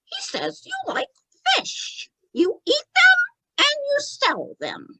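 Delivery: very high, thin spirit-medium falsetto; Tommy. Acted. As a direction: relay a message in a squeaky puppet voice. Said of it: "Tommy says yes again".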